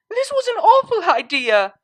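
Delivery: high, loud call over the wind. Acted. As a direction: shout it over a gusting wind. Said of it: "she called out over the wind".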